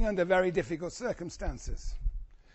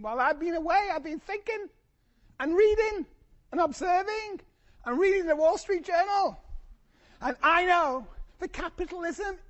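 higher, excited Lenin impression announcing world revolution; impersonating Lenin; 6 semitones higher, 3 dB louder than usual. Lecturer voicing Lenin's April argument, high and eager. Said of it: "And Lenin says".

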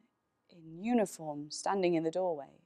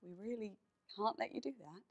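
soft, kind but firm refusal; gentle refusal; 3 semitones higher, 10 dB quieter than usual. Acted. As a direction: let them down gently, voice hushed. Officer gently refusing grieving mother.

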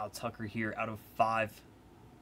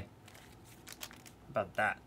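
whispered aside; 3 semitones lower, 9 dB quieter, much slower than usual. Acted.

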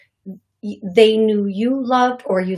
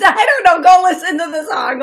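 high, loud laughing talk; 7 semitones higher, 8 dB louder than usual. Real, not acted. Medium laughs at the odd Oh Red song. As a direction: laugh it out, higher and louder.

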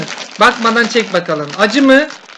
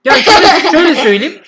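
laughing; 6 semitones higher, 6 dB louder than usual. Real, not acted. Laughing, burning mouth.

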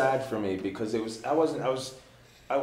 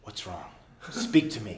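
soft concern; 5 semitones lower, 5 dB quieter than usual. Retold or imitated. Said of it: "And he goes".

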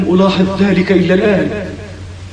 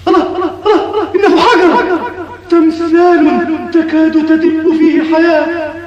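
frightened; 6 semitones higher than usual. Acted. Rising alarm at a stone statue.

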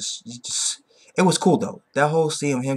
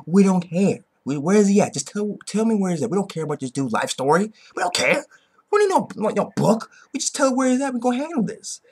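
higher, brushing off the story; impatient Saitama; 7 semitones higher than usual. Retold or imitated. Saitama telling the gorilla to skip the backstory, impatient. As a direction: wave away the backstory, just get to it. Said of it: "Saitama and Jim was like".

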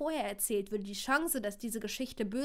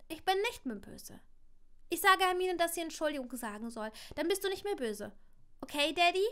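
put-on childlike; 6 semitones higher, 3 dB louder than usual. Child explains forgiveness to father.

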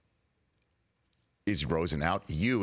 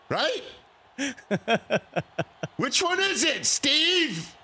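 much higher, louder mock demand; incredulous high call; 4 dB louder, much slower than usual. Real, not acted. Host challenging the GM on air, high amused outcry. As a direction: call out to Steve in disbelief.

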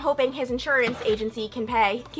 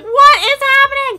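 distressed outcry; 14 semitones higher, 14 dB louder than usual. Retold or imitated. Crying, overwhelmed by the chase.

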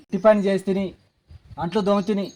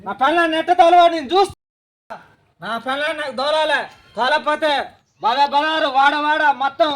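raised voice, threatening to shame her; angry threat; 3 semitones higher than usual. Acted. Son-in-law shouting threats at his mother-in-law over his wife.

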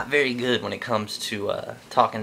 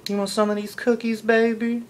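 imitating a girl talking dirty; 11 semitones higher than usual. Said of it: "they could be like".

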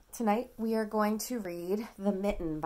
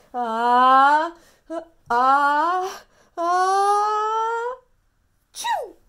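loud sneeze building higher; big sneeze; 8 semitones higher, 12 dB louder, much slower than usual. Acted. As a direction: wind up a huge sneeze. Bear sneezes.

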